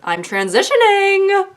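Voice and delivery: very high, slow mock voice; drawn-out airy mockery; mock tween. A vlogger mocking tweens, in a very high slow voice. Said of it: "they're just like".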